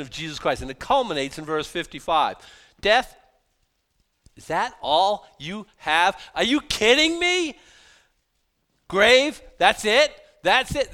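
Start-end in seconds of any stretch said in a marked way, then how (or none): 2.8-10.9 high, loud mocking taunt at death; divine trash talk. Preacher voicing the apostle taunting death, loud and mocking. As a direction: taunt death like a fighter calling out a punk. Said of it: "this is divine trash talk".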